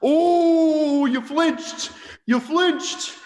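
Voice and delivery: high mocking taunt; Mark Messier impression. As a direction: taunt like a cocky hockey captain. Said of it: "was like".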